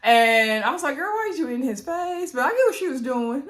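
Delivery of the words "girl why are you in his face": high-pitched teasing protest; mock disbelief. Recapper teasing Cochia for leaning in, mock disbelief. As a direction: call out a girlfriend, voice pitched up.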